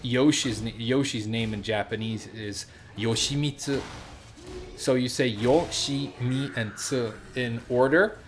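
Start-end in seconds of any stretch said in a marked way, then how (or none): 3.0-3.8 "Yoshimitsu" with every syllable equally timed and unstressed; flat, even syllables. Shown as a demonstration. Teacher showing Yoshi's name in Japanese, flat and even. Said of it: "flat, and you pronounce each syllable". 5.3-7.1 "Yo, Shi, Mi, and Tsu" as separate, evenly timed beats; syllable by syllable. Shown as a demonstration. Teacher breaking down a Japanese name, syllable by syllable. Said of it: "flat, and you pronounce each syllable".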